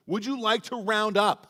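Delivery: mimicking the cashier's upbeat ask; mock cashier. Pastor imitating the Taco Bell cashier, mock cheerful. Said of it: "they're like".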